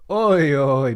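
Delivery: loud, excited "oi, oi" call; hype call. Real, not acted. Streamer cheering the McLaren reveal, loud and excited. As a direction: shout it to hype up the chat.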